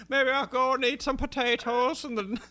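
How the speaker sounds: high, loud hammed-up Irish accent; a jokey over-the-top Irish voice; mock Irish accent. Podcaster mocks the show's Irish accents in a hammed-up Irish voice. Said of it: "shitty Irish accent".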